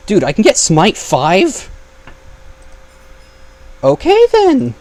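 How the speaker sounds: loud, high-pitched disbelief; incredulous high exclamation; amazed. Real, not acted. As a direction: blurt it out, astonished, voice shooting up. Surprised by unexpectedly strong enchantment.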